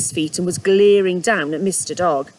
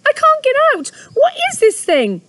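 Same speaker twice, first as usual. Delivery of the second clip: panicked; 6 dB louder than usual. Acted. Trapped rabbit panicking.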